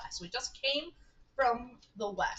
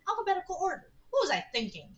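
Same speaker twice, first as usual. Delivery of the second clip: high-pitched, incredulous echo; incredulous self-reproach; 10 semitones higher, 4 dB louder, faster than usual. Acted. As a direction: repeat it as if appalled at yourself. Character scoffs at own filing.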